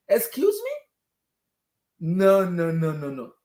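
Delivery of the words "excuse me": high incredulous squeak; sky-high offended excuse me; outraged excuse me. Real, not acted. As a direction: pitch it way up in disbelief. Outraged fan.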